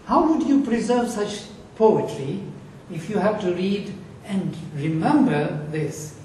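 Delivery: usual speaking voice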